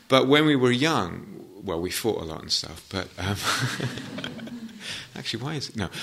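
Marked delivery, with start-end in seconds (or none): 3.4-5.1 high, light chuckle; real laugh slipping out mid-story; a little high-pitched giggle; amused laugh. Real, not acted. Amused recalling childhood fights.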